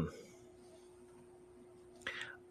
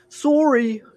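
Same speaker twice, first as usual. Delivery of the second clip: high, loud mock-Canadian SORRY; Canadian accent; 7 dB louder than usual. Teasing the other about his Canadian accent, exaggerated Canadian. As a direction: put on a Canadian accent, pitched way up. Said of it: "as soon as you said Canadian accent".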